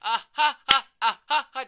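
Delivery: loud forced laughter; fake laugh demo. Shown as a demonstration. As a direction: laugh loudly on purpose. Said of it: "これ二種類あります".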